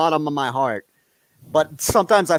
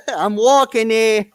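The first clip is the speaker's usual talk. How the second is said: loud, slow, higher New York holler; mock New York accent; 5 semitones higher, 5 dB louder, slower than usual. Joking with co-host about New York, hammed-up New York accent. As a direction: imitate a New Yorker hollering, drawn out. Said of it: "That's Rick and Morty jokes for you".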